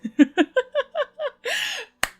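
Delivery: real laugh breaking out high; high burst of laughter. Real, not acted. Narrator cracks up at her character, laughing.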